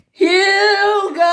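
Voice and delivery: very high, loud drawn-out name; scream. Acted. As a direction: bellow the name in a long, high yell. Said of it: "he screamed".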